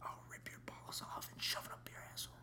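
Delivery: menacing whisper. Acted. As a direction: whisper the threat coldly. Whispered mock threat.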